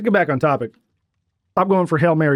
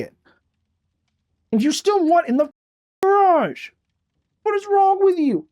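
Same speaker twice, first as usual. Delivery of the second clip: talking to the cat; 9 semitones higher than usual. Real, not acted. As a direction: coo a hurt complaint at the cat. Baby-talk lecture to cat.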